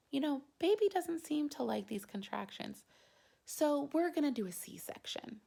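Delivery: soft, high, calm doctor voice; imitating a calm unhurried doctor. Doctor calmly tells the mother about the C-section, soft and high. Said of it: "The doctor gets to be all calm".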